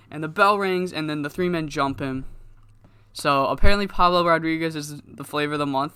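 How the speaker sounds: normal talking voice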